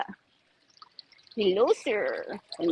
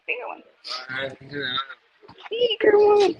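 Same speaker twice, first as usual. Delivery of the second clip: excited; 8 semitones lower than usual. Shown as a demonstration.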